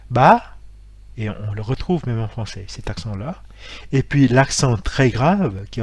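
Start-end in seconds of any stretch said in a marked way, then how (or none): 0.1-0.6 ba qui ne fait que monter; ba lancé vers le haut; rising acute tone. Shown as a demonstration. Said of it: "ça ne fait que monter". (ba avec accent aigu montant)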